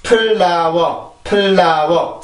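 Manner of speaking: slow "플라워" in three clear syllables; 세 음절 한국식 발음. Shown as a demonstration. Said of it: "삼 음절이죠". ("플라워"를 세 음절로 느리게 늘여 발음)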